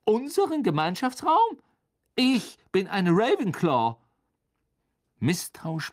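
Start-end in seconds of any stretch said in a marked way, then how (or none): 0.0-4.0 put-on indignant, high-pitched girl figure; stiff. A narrator's reading. Ravenclaw girl corrects Ron, stiff and indignant. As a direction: echo it haughtily, then correct them. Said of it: "sagte das Mädchen steif".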